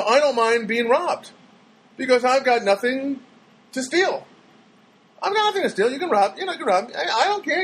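channel Bruce Smirnoff's comic cadence. Retold or imitated.

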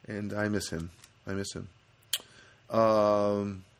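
normal talking voice

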